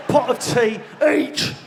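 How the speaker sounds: bellowed order. Acted. Ordering tea at an English B and B, bellowed.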